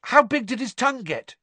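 eager, high-pitched question. A narrator's reading. George asking after his prank's result, eagerly. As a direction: ask it with keen curiosity. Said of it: "George asked eagerly".

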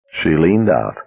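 ordinary delivery

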